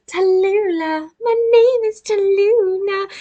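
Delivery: high, drawn-out monkey character voice; Tallulah. Retold or imitated. Monkey announcing her name, hammed-up high voice. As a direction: introduce yourself in a slow, squeaky-high put-on voice. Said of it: "Tallulah the monkey".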